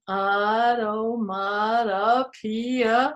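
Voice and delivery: "automatopoeia" slow, syllable by syllable; very slow teaching pronunciation of "automatopoeia"; sounding out slowly. Shown as a demonstration. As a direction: stretch out every syllable of "automatopoeia". Sounds out the big word.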